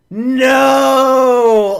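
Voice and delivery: loud cry of despair; anguished shout. Real, not acted. Fan hearing the flag is void, loud and pained. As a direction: let out a pained no.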